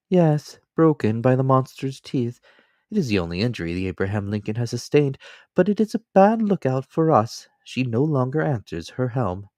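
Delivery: a loyal manservant, calm and deferential; Counsel. Acted. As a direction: servant offering his shoulder to his master, politely. Servant Counsel speaks.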